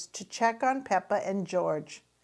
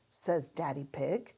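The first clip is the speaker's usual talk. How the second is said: ordinary delivery, nothing marked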